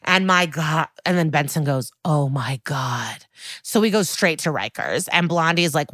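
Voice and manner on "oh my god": slow, lower-pitched oh my God; slowed right down, dropped in pitch; appalled realisation. Retold or imitated. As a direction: draw it out in retold dismay. Retold detective's horrified realisation.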